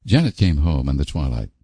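usual speaking voice

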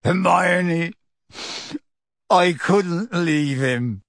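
gentle giant pleading through tears; tearful. A narrator's reading. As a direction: choke out the confession slowly. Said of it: "tears now trickling down his bruised face".

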